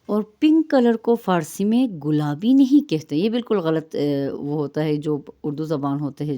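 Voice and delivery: ordinary talk, nothing marked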